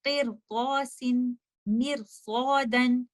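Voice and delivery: मिरसौदन with a thick, heavy ra, said slowly; slow, careful recitation with a heavy ra. Shown as a demonstration. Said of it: "आप इस रॉ को heavy पढ़ेंगे". (भारी रा के साथ धीरे मिरसौदन)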